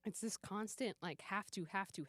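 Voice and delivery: ordinary talk, nothing marked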